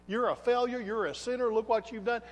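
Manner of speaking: ordinary talk, nothing marked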